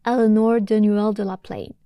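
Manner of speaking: an English actress's take on a French name; English accent. Shown as a demonstration. Teacher imitating the Irish actress saying the name, English accent. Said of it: "avec un accent anglais".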